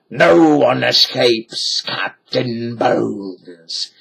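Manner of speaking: slow, mock-menacing pirate voice; pirate roaring. A narrator's reading. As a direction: boast slowly like a pirate captain. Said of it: "he roared".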